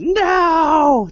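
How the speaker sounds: high, drawn-out mock scream; retold scream. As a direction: wail the word out high and long. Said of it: "I hear this screaming".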